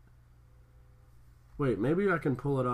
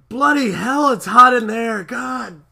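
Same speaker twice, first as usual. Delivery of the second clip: loud, high mock exasperation; exasperated outburst; 8 semitones higher, 10 dB louder, slower than usual. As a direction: blurt it out, fed up. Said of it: "she's like".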